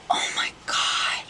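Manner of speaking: slow, stunned whisper; awed whisper. Real, not acted. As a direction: whisper it slowly to yourself. Stunned at cutting own hair.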